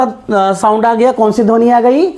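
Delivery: ordinary delivery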